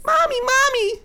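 put-on extremely high, squealing child; hammed-up tiny kid calling mother; scary child voice. Child calling mommy to look, scary squeal. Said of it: "in that scary voice".

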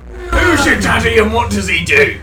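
loud, high mock interrogation; mock shout. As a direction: bellow it like a movie cop. Quoted movie line, mock interrogation.

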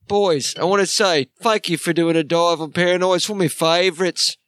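mock Ozzy British accent. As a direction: chat like [name] in a mild English accent. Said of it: "I came from the south side of the Bronx of England".

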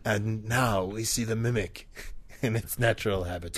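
hushed wildlife narrator; nature documentary. Pretend.

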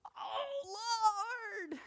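very high, loud wailing "Oh, Lord"; mock-dramatic loud cry; emphatic cry. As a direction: wail it out, overdoing the emphasis. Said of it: "I mean, emphatically".

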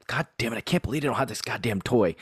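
ordinary talk, nothing marked

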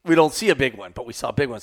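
ordinary delivery

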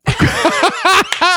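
shocked squeal of a laugh; real startled laugh; high burst of laughter. Real, not acted. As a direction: laugh high and loud, caught off guard.

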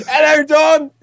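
high shouted hello back; loud greeting. Real, not acted. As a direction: call his name out loud and high.

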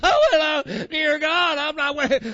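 high-pitched imitation of wailing; mock whining. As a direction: whine it out in exaggerated despair. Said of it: "Don't sit there and whine about it".

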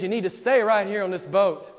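loud, high, commanding tempter; forceful preacher voicing the devil; Satan insisting. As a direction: order him to stay, at full voice. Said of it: "Satan says".

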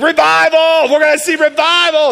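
high, loud revival cry; shouting revival with wild excitement; excited shout. Retold or imitated. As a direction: belt it out like a hyped-up preacher. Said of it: "He's like".

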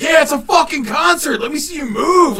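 high loud hype-up yell; rock star shout. Retold or imitated. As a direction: fire up the crowd. Drunk rock star hyping crowd.

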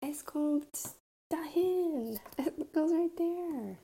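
high and slow through giggles; laughing. Real, not acted. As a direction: laugh the words out playfully, up high. Laughing playful answer.

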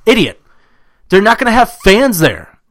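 high, loud retold comeback; exasperated retort. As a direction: snap back at someone in an argument. Retells his side of an argument.

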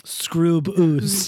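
hammed-up Canadian vowels, "scroob oos"; mock Canadian accent. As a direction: turn both vowels into long "oo" like a cartoon Canadian. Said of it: "What would Canadians say?".

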